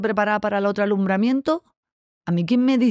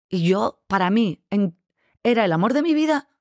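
ordinary talk

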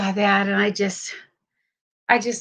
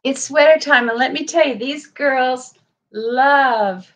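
sing-song excitement; 5 dB louder than usual. Real, not acted.

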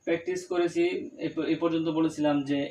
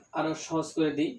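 ordinary delivery

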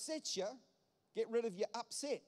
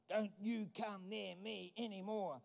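slow, understated acted warning; warding someone off; 3 dB quieter, slower than usual. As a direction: slowly mark off a boundary, in a held-back voice. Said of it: "you will put posts in the ground".

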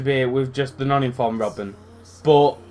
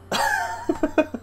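very high wheezy giggle; squeaky real laughter; helpless laugh; 4 dB quieter than usual. Real, not acted. Gamer laughing at an accidental goal, helpless laugh.

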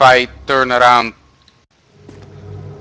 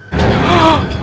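sharp gasp; 3 dB louder than usual. Real, not acted. Jump scare reaction.

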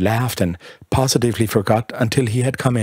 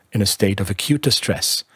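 usual speaking voice

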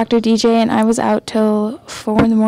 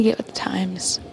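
whispered tease; whispering; 7 dB quieter than usual. Real, not acted. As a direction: whisper it as a jab. Teasing aside.

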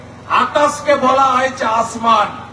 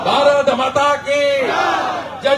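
slogan shout; 2 semitones higher, slower than usual. Real, not acted. Closing slogans shouted at rally.

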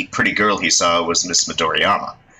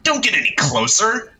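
higher, forceful order; urgent command; 12 semitones higher than usual. Acted. Reporter holding youths back from fire, urgent command. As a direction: hold them back with your voice.

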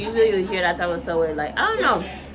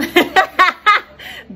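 bright laugh; 11 semitones higher, 7 dB louder than usual. Real, not acted. Laughing at own accent.

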